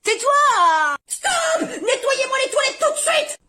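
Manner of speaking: high, furious shout; angry mother shout. Acted. Mother fed up with squabbling kids, high angry shout. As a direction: blow up at the kids.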